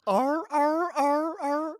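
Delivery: high squeaky otter barks. Pretend. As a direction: yelp like a playful sea otter. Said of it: "Were they making sea otter noises?".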